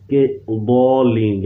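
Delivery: ضالین drawn out slowly, ض from the side of the tongue; correct ض demo. Shown as a demonstration. Teacher shows students the true ض sound, slow and deliberate. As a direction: say ضالین very slowly, shaping the ض carefully. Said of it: "اصل لفظ ہے".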